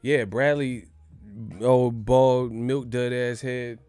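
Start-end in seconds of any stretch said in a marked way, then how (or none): none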